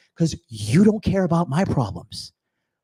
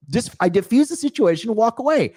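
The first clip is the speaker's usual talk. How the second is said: usual speaking voice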